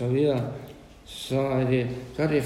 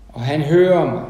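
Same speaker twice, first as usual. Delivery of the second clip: booming declaration with hører stressed; loud proclamation; 10 dB louder than usual. Acted. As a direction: announce it full voice to the hall. Preacher proclaiming scripture loudly. (kraftfuld forkyndelse)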